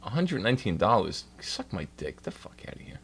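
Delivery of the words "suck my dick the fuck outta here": whispered scoff. Real, not acted. Scoffing at the price.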